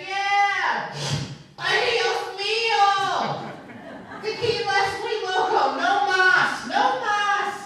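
put-on high, loud drunken yelling; hammed-up tipsy party shout. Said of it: "I was like".

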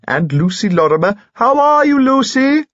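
climbing to a high, loud greeting; delighted. Acted. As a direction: hail an old friend with rising glee. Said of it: "he said delightedly".

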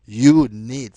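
"you need" punched out loud, with heavy stress on "you"; emphasizing. Real, not acted. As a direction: hammer home "you need" and put all the weight on "you". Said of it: "I'm emphasizing this because".

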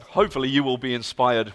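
usual speaking voice

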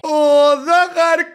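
usual speaking voice

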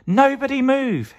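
very high urgent call; high-pitched acted shout; shouted warning. A narrator's reading. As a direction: freeze everyone with a high cry. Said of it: "he shouted".

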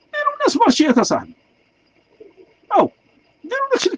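high-pitched exasperated plea, falling at the end; exasperated appeal. Real, not acted. Citizen urging officials to build markets, exasperated appeal.

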